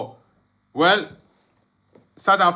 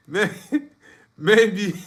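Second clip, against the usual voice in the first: real laughter breaking up the word; helpless chuckling as the word comes out; laughing; 3 semitones higher, 3 dB quieter than usual. Real, not acted. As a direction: try to say it through laughter. Cracking up while answering viewer.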